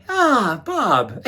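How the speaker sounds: bright "aha" as the name comes back; sudden recognition. Retold or imitated. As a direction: pitch jumps on "Ah". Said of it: "come back and go".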